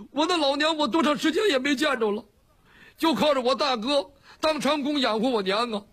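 high-pitched, hammed-up tearful lament; tearful wailing complaint. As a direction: wail it like an aggrieved son missing his mother. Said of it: "你哭什么呀？".

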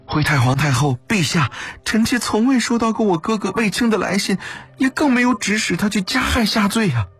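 high-pitched pleading denial; anxious, urgent. A narrator's reading. Kneeling consort answering the grand empress dowager, anxious. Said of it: "卫子夫急切地回道".

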